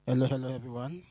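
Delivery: usual speaking voice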